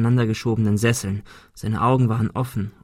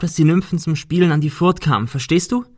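higher, insistent telling; urgent storytelling; 6 semitones higher, 3 dB louder than usual. Acted. Ben tells the injured Cecil a story, urgently. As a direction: keep him listening with a higher voice.

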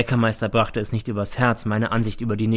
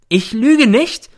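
high, slow, outraged yell; sudden bellow; 13 semitones higher, 10 dB louder, much slower than usual. A narrator's reading. Mother exploding at her son, bellowing. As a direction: burst out at full volume. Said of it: "brüllt sie plötzlich los".

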